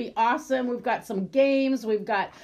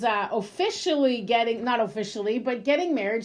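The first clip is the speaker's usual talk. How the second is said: ordinary delivery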